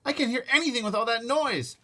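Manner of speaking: high, loud complaint; yelling. Acted. Boy shouting over pots and pans. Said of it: "I yelled at Fudge".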